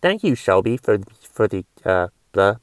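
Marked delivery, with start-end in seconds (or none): none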